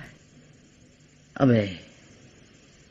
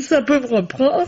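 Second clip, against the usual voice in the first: words with a light laugh, pitched up; laughing a little; 4 semitones higher than usual. Acted. The maid telling the inspector her tears may return, laughing lightly. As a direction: say it half-laughing. Maid unsure if she'll cry again.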